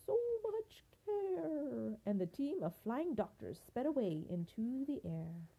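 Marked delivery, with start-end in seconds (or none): 0.0-2.0 storybook unicorn, pitched way up; grateful unicorn. A narrator's reading. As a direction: let the thanks ring out high like a unicorn. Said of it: "said the unicorn".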